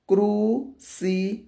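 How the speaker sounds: "crucifixo" in four separated pieces; syllable by syllable. Shown as a demonstration. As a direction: sound out "crucifixo" with a pause after each syllable. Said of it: "Quatro sílabas".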